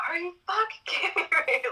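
high, laughing disbelief; half-laughing at fate, voice jumping way up; incredulous outburst. Retold or imitated. To the universe, laughing in disbelief at another hard blow. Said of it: "I'll be like".

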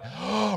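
sharp gasp before the name; startled gasp; gasp of recognition. A narrator's reading. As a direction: catch your breath in shock. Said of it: "took a good hard look".

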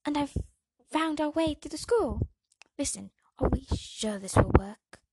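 loud, high and eager; over-enthusiastic. Acted. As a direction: push too hard to join in. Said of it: "who's acting over-enthusiastic to join in".

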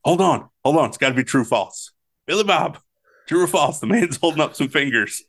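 high-pitched, hammed-up interruption; mock urgent interjection. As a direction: imitate someone urgently halting everything for a quiz. Acted sideline argument over the answer.

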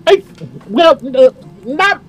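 high, hesitant voice caught out; flustered elf woman. Acted. Elf mother caught by her children's questions, flustered high voice. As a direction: stall nervously before admitting it.

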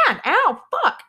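high, loud yelp of pain; retold pained scream. Middle schooler whose hand got stepped on, pained scream. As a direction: yell it out like a stomped hand. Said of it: "I actually kinda screamed a little bit".

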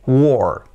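"war" as "wor" with long o; rhymes with door. Shown as a demonstration. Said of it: "como pronunciamos la palabra puerta". ("war" como door, con o larga)

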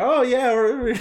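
put-on little kid. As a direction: imitate a thrilled kid in the car. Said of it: "she's like a little kid in the car".

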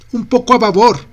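high, loud call across the deck; shouting. A narrator's reading. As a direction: shout the order to the helm. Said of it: "grita Ashley".

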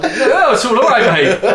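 high laughing mock reassurance; lightly voiced chummy hello through a laugh; jolly mate. Freddie on the train reassuring him, high and laughing. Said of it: "Freddie sitting there as well".

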